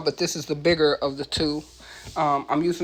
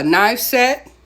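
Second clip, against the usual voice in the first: normal talking voice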